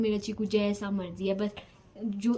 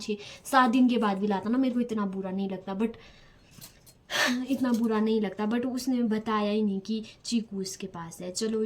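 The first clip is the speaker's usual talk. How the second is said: ordinary delivery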